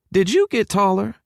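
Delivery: high-pitched motherly question; high Texan mother voice; doting southern mama. A narrator's reading. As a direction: ask your son in a high southern voice. Mama fusses over her son.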